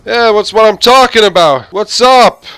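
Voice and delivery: high, loud, hyped-up "yo, what's up" street greeting; loud American guy greeting. Retold or imitated. A guy on the street greeting a stranger, loud and brash. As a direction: holler it like a brash New Yorker passing by. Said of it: "some guy walking down the road and be going".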